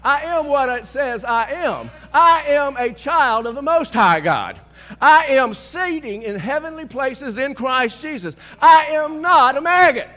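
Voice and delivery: high, loud, slow shouted proclamation; yelled declaration. Retold or imitated. Preacher leading the men in declarations, full yell. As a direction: bellow it like a battle cry. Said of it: "I began to yell out".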